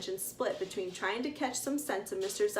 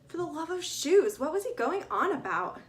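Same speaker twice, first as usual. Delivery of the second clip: higher, louder exasperated complaint; exasperated groan; 7 semitones higher, 4 dB louder than usual. A narrator's reading. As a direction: groan it out in disbelief. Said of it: "Stephanie groaned".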